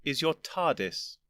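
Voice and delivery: slow, lightly voiced weary old gentleman; drawn-out patient lecture; slow and weary, humouring him; weary patience. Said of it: "with an air of weary patience".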